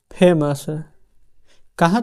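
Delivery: ordinary delivery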